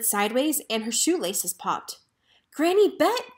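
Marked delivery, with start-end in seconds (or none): 2.5-3.4 high, excited call of her name; cried out. Acted. Hank crying out to Granny Bet amid the chaos, excited. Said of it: "cried Railroad Hank".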